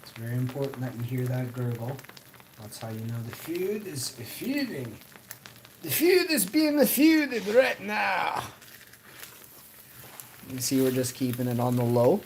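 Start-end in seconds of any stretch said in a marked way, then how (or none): 5.8-8.4 excited. Real, not acted. Excited food commentary.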